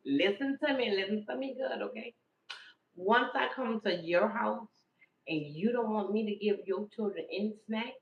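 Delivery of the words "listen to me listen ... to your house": ordinary talk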